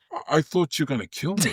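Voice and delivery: high, hushed mock fright; retold terror. Fellow actor confronting his attacker after the scene, retold terror. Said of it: "He was absolutely terrified".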